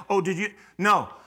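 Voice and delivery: ordinary talk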